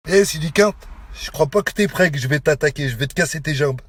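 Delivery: real threat, voice dropped low; low menace. Real, not acted. Man threatening his rival to break legs, low menace.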